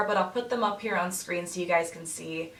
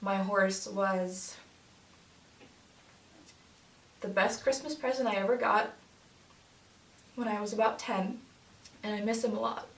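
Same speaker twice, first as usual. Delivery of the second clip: getting emotional; 4 dB quieter than usual. Real, not acted. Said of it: "I'm getting emotional".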